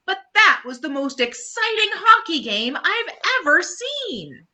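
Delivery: put-on a kid won over despite his doubts; Franklin admitting. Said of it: "Franklin admits".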